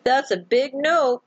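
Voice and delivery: high, drawn-out refusal; emphatic nope. Retold or imitated. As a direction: firmly shut the idea down. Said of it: "I'm like".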